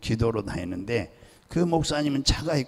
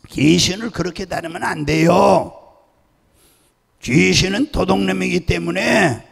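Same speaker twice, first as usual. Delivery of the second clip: loud, pitched-up admonishing; raised-voice scolding lecture; forceful correction; 6 semitones higher, 7 dB louder than usual. Retold or imitated. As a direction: set a colleague straight emphatically. Corrects a colleague's way of exorcising.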